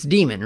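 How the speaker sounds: long ee opening Demon; DEE-mun pronunciation. Shown as a demonstration. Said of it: "however you prefer to pronounce it".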